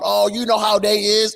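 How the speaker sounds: mock slang voice.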